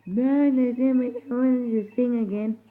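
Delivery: high voice. Pretend. High voice after singing.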